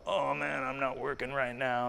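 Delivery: softly mumbled mock-drunk excuse; imitated drunk. Drunk man explaining himself to the preacher, in a soft put-on voice. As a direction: play a drunk making excuses, quietly. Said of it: "just red-faced drunk".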